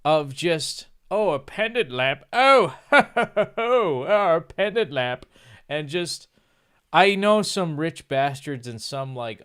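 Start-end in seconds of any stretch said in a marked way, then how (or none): 1.1-5.3 hammed-up pompous snob with a haughty laugh; mock a stuck-up posh twit, much higher and louder; lofty sneering voice with a fake ho ho ho. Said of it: "It's the pompousness of just".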